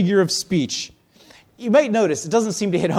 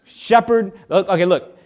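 louder, exasperated try again; frustrated re-explaining; 3 semitones higher, 10 dB louder than usual. Acted. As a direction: start over like a teacher losing patience.

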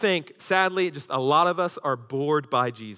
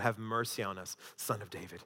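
soft, much quieter pleading; hushed plea; 9 semitones lower, 14 dB quieter than usual. Retold or imitated. Blind men pleading to Jesus, softly voiced. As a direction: beg for mercy under the breath.

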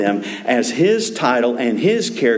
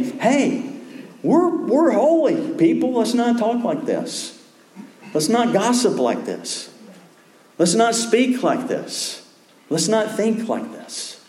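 higher-pitched, emphatic mock appeal; earnest urging; 9 semitones higher than usual. Believer urging friends to live as holy people, earnest appeal. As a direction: plead it in short, separate appeals.

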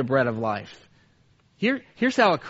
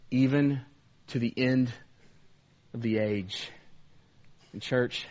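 low, much softer, tender voice; hushed pastoral appeal; 6 semitones lower, 6 dB quieter than usual. Real, not acted. Pastor gently assuring the church that Jesus is with them, hushed. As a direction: draw them in gently before prayer.

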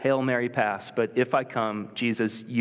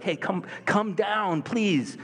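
pleading call down; 8 semitones higher, slower than usual. Retold or imitated. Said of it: "so it makes sense to be like".

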